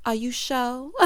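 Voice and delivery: ordinary talk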